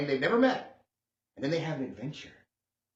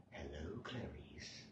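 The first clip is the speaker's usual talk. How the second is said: low, slow, much softer menacing murmur; creepy killer impression; 12 semitones lower, 21 dB quieter, much slower than usual. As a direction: lean in like a caged killer. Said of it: "leaning forward going".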